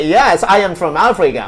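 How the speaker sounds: laughing as you retell your bold reply; retold playful answer. Man answering a foreign woman's guess, loud retold joke. Acts out his own joking answer.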